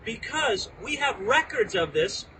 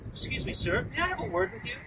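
overly polite request; 9 semitones higher, 5 dB quieter, faster than usual. Retold or imitated. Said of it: "far too polite and reserved".

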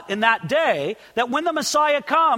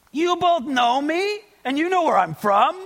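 mildly ironic; 5 semitones higher than usual. Retold or imitated. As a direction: cry it out as a doubting question. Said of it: "he was being ironic or sarcastic".

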